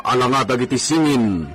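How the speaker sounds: ordinary talk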